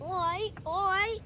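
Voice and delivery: mock Australian calls. Kid calling at the train in a mock Australian accent, then quieting. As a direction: shout then trail off like an Aussie. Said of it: "Australian accent".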